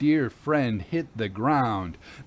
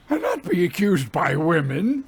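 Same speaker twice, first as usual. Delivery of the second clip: higher, louder shriek of outrage; shrieking captain; 5 semitones higher, 6 dB louder than usual. A narrator's reading. Captain to the women, shrieking in outrage. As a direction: screech it, outraged at being accused. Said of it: "the captain shrieked".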